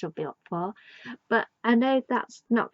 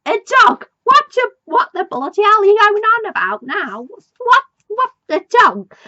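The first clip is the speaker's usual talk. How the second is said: much higher, much louder exasperated shouting; exasperated yelling; 11 semitones higher, 11 dB louder than usual. Retold or imitated. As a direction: blow up in disbelief as Jericho. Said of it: "And Jericho's like".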